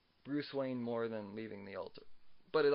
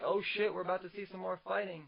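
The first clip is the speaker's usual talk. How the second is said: surprised reaction; 7 semitones higher than usual. Retold or imitated. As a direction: caught off guard, fast and eager. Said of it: "at first I was like".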